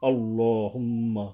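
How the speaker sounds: আল্লাহুম্মা said slowly with a long humming nasal মম; slow recitation-style আল্লাহুম্মা; nasal ghunna demo. Shown as a demonstration. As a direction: draw out the মম in আল্লাহুম্মা through the nose. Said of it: "এইভাবে আর কি".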